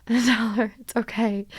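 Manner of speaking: normal talking voice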